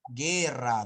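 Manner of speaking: "guerra" loud, as gerra with hard G; emphatic guerra, no W after G; silent U. Shown as a demonstration. Teacher giving correct form, demonstrating. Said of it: "No dices guerra".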